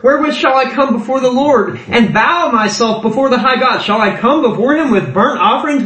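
high, rising rhetorical questions; declaimed scripture, insincerely asked; mock-earnest questioning. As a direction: pile up absurd offers as pointed questions. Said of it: "He's saying it in a way that he's like".

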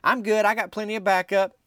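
high-pitched, untroubled imitation of a woman; breezy woman's reply. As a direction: toss it off lightly up high.

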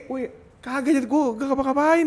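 high, fast startled protest; mock startled. Defending himself before the crowd, high startled protest. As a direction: jump high in mock shock. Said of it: "gue jadi kayak".

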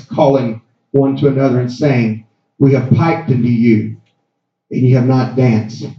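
low, solemn Bible reading; scripture reading. Acted. Reads scripture aloud.

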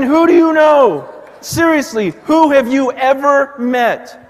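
pastor preaching hard to the congregation, loud and fervent. Acted. Preaching at full force to congregation.

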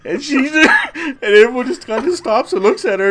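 high, loud, laughing through the words; cracking up. Real, not acted. Storyteller to co-host after the cat food punchline, cracking up. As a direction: struggle to tell it while laughing hard.

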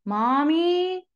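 put-on high little-kid voice calling for mommy; child calling out. Child calling mom back from bed, high and small. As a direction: call out like a small child who won't sleep.

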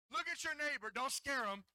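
ordinary talk

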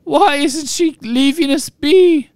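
high squeaky sobbing elf; house-elf voice. A narrator's reading. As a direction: squeak it out, tearful. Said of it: "sobbed Winky".

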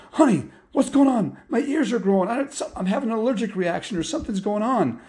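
high, loud frantic babble; panicked. Acted. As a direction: rush it out in a panic. Dad panics about his ears.

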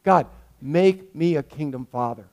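model prayer. Shown as a demonstration. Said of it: "We should be saying".

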